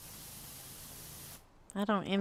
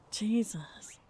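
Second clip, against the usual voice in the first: soft breathless whisper; under-the-breath whisper; whispered Jesus; 9 dB quieter than usual. Real, not acted. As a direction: breathe it out, exasperated. Muttered aside after story.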